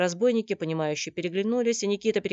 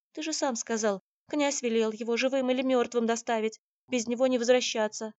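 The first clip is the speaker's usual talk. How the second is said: higher, insistent female voice; worried girl; 7 semitones higher, 4 dB louder than usual. Acted. Girl reminds Никита of the prince's order, high anxious protest. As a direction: press the worry in a higher voice.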